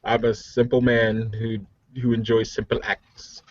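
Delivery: ordinary talk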